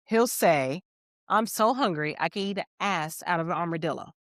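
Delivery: ordinary talk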